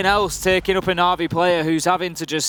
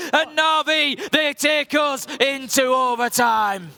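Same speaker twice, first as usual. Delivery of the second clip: rising hyped-up caster call, higher; excited overtime call; 6 semitones higher, slower than usual. Real, not acted. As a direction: build to the big overtime moment.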